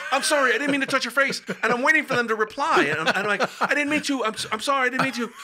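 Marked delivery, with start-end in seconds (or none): 0.1-1.4 high, loud, acted-out panic; mock panicked apology. Apologizing to a body after touching its face, mock panicked. 3.6-5.3 high-pitched, stumbling acted panic; mock panicked apology. Waiting for the dead to reply, panicky mock apology.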